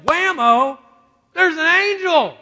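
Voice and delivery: loud, high burst of astonishment; startled storyteller's shout; sudden shock. Acted. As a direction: blurt it out like a jump scare. Acting out sudden angel appearance.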